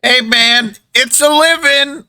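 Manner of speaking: put-on high-pitched kid defending his trade; paperboy child. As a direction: answer back in a piping street-kid voice. GM plays the paperboy.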